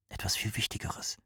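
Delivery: secretive whisper; hushed, voiceless confiding. A narrator's reading. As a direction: whisper it with weight. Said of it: "flüsterte Rahmane".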